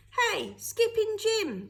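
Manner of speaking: high, loud and slow call down the alley; shouted. A narrator's reading. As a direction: hail him from a distance. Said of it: "they shouted".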